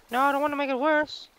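very high, distressed squeal; panicky whine. Real, not acted. Gamer making the problem worse, panicky whine. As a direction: wail it in a high pitch. Panicking while fixing mistake.

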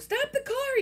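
high, loud cry to stop the car; shout. Acted. Boy in the car shouting at his mother, high and loud. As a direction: yell it out in sudden panic. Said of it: "he shouted".

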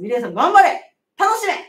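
loud, rousing cheer. Real, not acted. Midwife cheering on a mother-to-be, loud rousing cheer. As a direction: shout encouragement to her.

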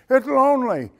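normal talking voice

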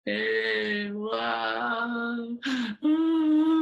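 sobbing wail rising to a high loud cry. Acted. Bluebird bursting into tears before the owl, loud wailing. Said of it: "loudly cried the bluebird".

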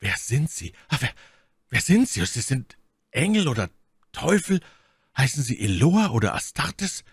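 halting, awestruck questioning; fassungsloser Ausruf. A narrator's reading. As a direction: ask in awe, breaking off and restarting. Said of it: "war tief versteinert und rief". (stockend, ehrfürchtig staunend)

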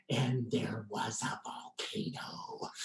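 slow, soft secretive whisper; hushed whisper. Real, not acted. Telling audience why Hawaii, hushed whisper. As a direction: let it out like a treasured secret. Savoring the volcano reveal.

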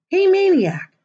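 high, loud eager call; excited blurt. Acted. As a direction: kid bursting to see him. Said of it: "blurted Piper".

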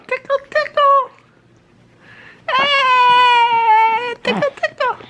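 high playful tickle sing-song; giggly tickle attack. Real, not acted.

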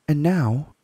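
put-on young girl begging for the story; Lucy's voice. Lucy asking Mr Beaver, eager girl voice.

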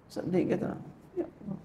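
near-whispered sad plea; hushed lament. Acted. Preacher lamenting to God for the congregation, sad and hushed. Laments losing God's regard.

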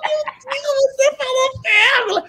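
very high mock cry of disbelief; esposa histérica. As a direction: imitate a hysterical wife accusing her husband. Wife insisting hysterically on pérgola. (imitação aguda de esposa incrédula)

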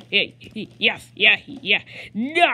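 loud yelled yeah; loud exasperated yeah; frustrated yell. Real, not acted. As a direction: shout it at the screen. Yelling in frustration.

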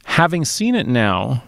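normal talking voice

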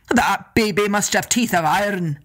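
a hint of a lumbering giant; giant's voice. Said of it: "Ben and Donner said".

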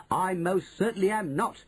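mild high-pitched shout; affronted boy's contained shout; indignant shout. A narrator's reading. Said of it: "he shouted".

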